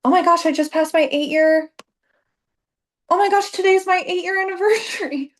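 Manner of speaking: high, loud gasp of surprise; sudden realization. Real, not acted. Coach suddenly realizing it is her business anniversary, high and surprised. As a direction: catch yourself realizing the date mid-sentence.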